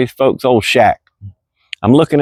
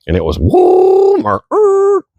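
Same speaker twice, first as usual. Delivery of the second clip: high whooping woo as the car swings round; whooshing sound; slower than usual. Retold or imitated. Telling of Grandma's fast cornering, high whoop. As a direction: sing out a high sliding woo.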